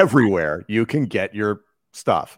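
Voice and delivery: loud, confident announcer sign-off; broadcast voice. Pretend. Guest improvises where to find the host's podcast, broadcast voice. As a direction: say nonsense with total broadcaster confidence. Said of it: "broadcast voice that has been".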